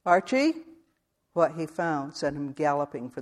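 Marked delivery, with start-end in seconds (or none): 0.0-0.5 called out loud and rising, into an empty cabin; calling out. Acted. Man calling for the McLavertys at their cabin door, loud and questioning. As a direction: call the names, unsure anyone is there.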